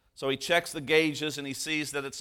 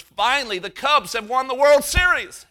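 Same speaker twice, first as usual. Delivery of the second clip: much higher, much louder joyful cheer; jubilant shout; 11 semitones higher, 10 dB louder than usual. Acted. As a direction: yell it like your team just won. Texan's punchline, jubilant cheer.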